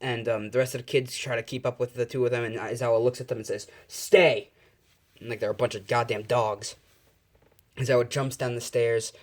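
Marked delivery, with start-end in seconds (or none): 3.9-4.5 loud, higher barked order; sharp command. Acted. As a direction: bark it like commanding dogs. Teacher commands kids like dogs.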